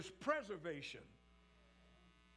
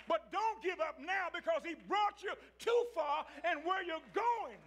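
preaching shout; 6 semitones higher than usual. Acted. Preacher voicing God to the weary congregation, full shout. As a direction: shout it out like a preacher getting happy. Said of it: "I said I wasn't gonna shout up here".

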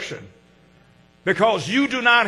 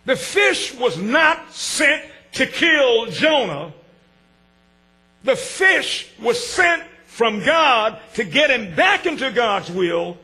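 slow preaching; 3 semitones higher, slower than usual. Acted. Preacher telling the congregation God sent the fish, slow and emphatic. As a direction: lean on each word like a preacher.

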